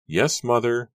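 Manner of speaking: high, loud, exasperated reply; impatient daughter's retort. A narrator's reading. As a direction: answer your mother impatiently, voice jumping up. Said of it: "Susanna replied impatiently".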